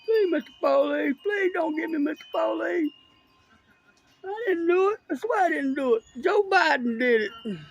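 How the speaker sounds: high, whiny begging voice, mocking the suspect; mock crying suspect. Filming a chase, he mocks the caught man: high begging whine. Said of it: "I hear him crying and hollering".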